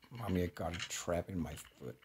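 usual speaking voice

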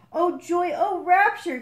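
high, loud joyful rapture; rapturous sigh. A narrator's reading. Frog overjoyed at finding his egg, hammed-up rapture. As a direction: swoon over the found egg. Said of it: "he sighed".

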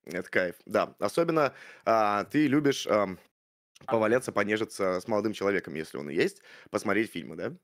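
usual speaking voice

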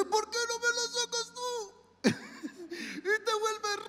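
voz aguda y rápida de pececito; un pececito que pregunta con picardía; voz de pez. Acted. El pez responde al holgazán. (voz aguda de pececito)

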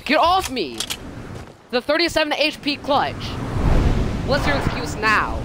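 high, hyped gloating; raging gamer. Real, not acted. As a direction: gloat over the win. Gloating after clutch win.

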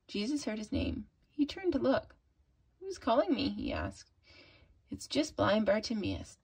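put-on soft, gentle read-aloud for kids; hushed storybook narration; story reading. A narrator's reading. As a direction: read the story quietly to little girls. Reads Bible story to children.

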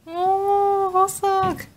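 high, drawn-out fond coo; high-pitched fan swoon; adoring coo. Real, not acted. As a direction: coo over someone adorable. Fawning over a favourite member's photos.

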